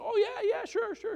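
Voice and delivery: squeaky sarcastic yeah sure; mock eager churchgoer. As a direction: parody glib churchgoers saying yes. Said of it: "They say".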